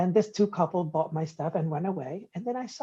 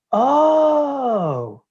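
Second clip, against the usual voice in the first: sudden realization; 5 semitones higher, 8 dB louder than usual. Retold or imitated.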